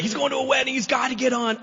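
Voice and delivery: high, loud put-on pleading; insistent friends. Drunk guy's friends talking him onto the plane, urgent and insistent. Acting friends lying to airline.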